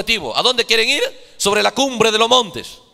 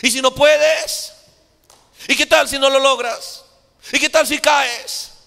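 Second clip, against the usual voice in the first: higher, taunting whispers of doubt, slowed; a hint of a tempting, doubting spirit; fearful doubting voice; 4 semitones higher, slower than usual. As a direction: voice the fear that nags you, lightly. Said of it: "Te empieza a decir".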